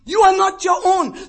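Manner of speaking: loud, rising pulpit preaching; full-force preaching. Acted. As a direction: thunder it out to the congregation.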